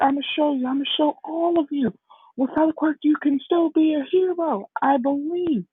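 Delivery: put-on high, earnest young hero voice; retold boy insisting he can do it; determined vow. Deku vowing to show all of you, determined. Deku vows to prove everyone wrong.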